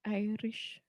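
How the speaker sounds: soft, slow attempt at Irish; Irish accent attempt. Shown as a demonstration. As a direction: carefully copy an Irish brogue. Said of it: "hear it and then repeat it".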